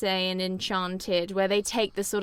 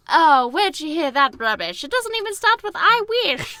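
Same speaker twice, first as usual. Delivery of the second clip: higher, louder snooty voice; mocking fairy-tale character; 7 semitones higher, 7 dB louder than usual. Modern fairy-tale character scoffing at a wrong spell, in a high snooty voice. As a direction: sneer at a misquoted fairy tale, pitched up. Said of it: "you know, when it's like".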